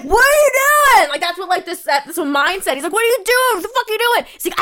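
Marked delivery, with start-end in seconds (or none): 0.0-1.1 high, loud imitated outrage; mock angry yell. Retelling the boyfriend yelling at the staring guy, mock loud yell. 2.9-4.2 high, imitated outraged demand; mock angry yell. Retelling the boyfriend confronting the creep, high mock yell.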